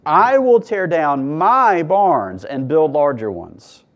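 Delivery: every I, my and he punched hard, slow and loud; reading with emphasis. Shown as a demonstration. Pastor reading the rich man's words with heavy emphasis. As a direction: hammer each personal pronoun like a jackhammer. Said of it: "I'm gonna read it with emphasis".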